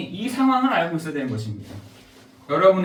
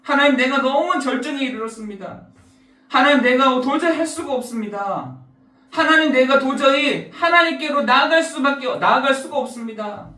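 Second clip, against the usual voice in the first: higher, louder crying-out prayer; desperate plea to God; 6 semitones higher, 3 dB louder than usual. Acted. Believer at his limit crying out loudly to God. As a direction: cry out to God at the end of your strength. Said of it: "한계 만난 내가 하나님 앞에서".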